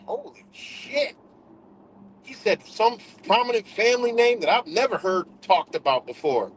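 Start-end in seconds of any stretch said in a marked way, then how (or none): 0.1-1.2 much higher, slow, hushed "Holy shit"; stunned amazement. Retold or imitated. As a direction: let it dawn on you slowly. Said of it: "I was like".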